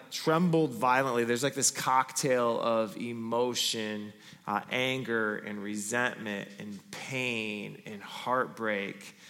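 normal talking voice

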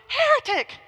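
very high, loud outraged cry; imitated scream. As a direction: hurl the accusation like a scandalized churchgoer. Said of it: "She responded".

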